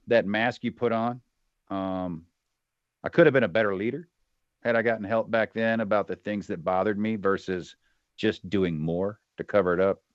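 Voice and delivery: usual speaking voice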